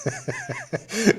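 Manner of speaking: short high burst of laughter; laugh at own joke. Real, not acted. Host laughing at his own parenting joke, short laugh. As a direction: laugh at your own punchline.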